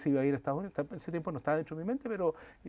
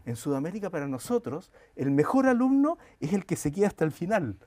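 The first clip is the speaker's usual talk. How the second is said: retelling the Argentine friend, higher and louder, with a lively push; animated quoted explanation; 6 semitones higher, 6 dB louder than usual. Retold or imitated. Argentine friend telling Chileans about exams, in an animated, louder voice. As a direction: bring the friend's point alive with gusto.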